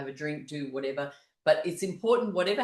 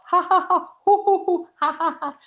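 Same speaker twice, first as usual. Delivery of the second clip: loud, high ha-has and hoo-hoos; playful high whooping laugh; pretend-hot laughter; 8 dB louder than usual. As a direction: laugh and hoot as if your drink scalded you. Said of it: "Pretend it's too hot".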